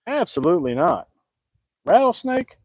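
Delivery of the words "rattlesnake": much higher, louder disbelieving rattlesnake; Rattlesnake? thrown up high in shock; incredulous. Real, not acted. Repeats in disbelief.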